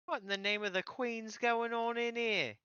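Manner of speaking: high, loud, indignant British voice; mock posh British. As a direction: bluster like an outraged Englishman.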